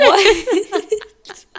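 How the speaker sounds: high laughing "what"; squealing with laughter. Real, not acted. Laughing reaction to the slip.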